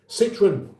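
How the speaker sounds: Citroen anglicised as "SIT-run"; British pronunciation. Shown as a demonstration. Host shows how we say it, British pronunciation. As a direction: say Citroen with English sounds, stress up front. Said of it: "that's how we say Citroen".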